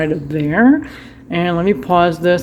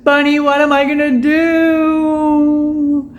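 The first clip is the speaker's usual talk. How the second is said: very slow, higher, half-crying plea; mock crying; 7 semitones higher, much slower than usual. To Bunny over the broken cup, a mock sob. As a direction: sob the question to the pet in jest. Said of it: "I am so sad".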